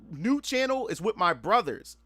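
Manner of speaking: usual speaking voice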